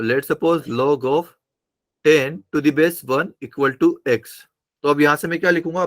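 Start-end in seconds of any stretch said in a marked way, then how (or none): 0.0-4.2 slow dictation. Shown as a demonstration. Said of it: "teacher dictates the example".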